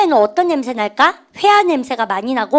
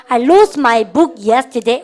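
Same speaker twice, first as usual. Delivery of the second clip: stretched, louder model sentence; slow classroom repeat-after-me; very slow drill reading; 4 dB louder, much slower than usual. Shown as a demonstration. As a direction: say it slowly so students can follow. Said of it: "시작".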